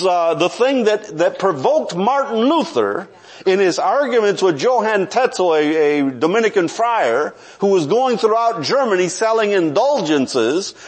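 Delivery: ordinary delivery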